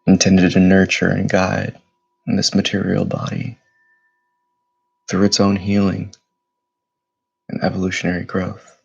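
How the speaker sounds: steady, even meditation voice with long pauses; measured, level-toned meditation leader, unhurried between breaths; guided meditation. Acted. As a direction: guide them gently inward, phrase by phrase. Leads guided meditation after bell.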